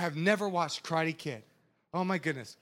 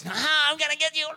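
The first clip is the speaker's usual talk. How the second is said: higher, playful villain taunt; mock menacing enemy; 5 semitones higher than usual. The enemy coming at you, mock threat. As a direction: taunt like a cartoon bad guy.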